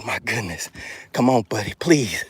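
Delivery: usual speaking voice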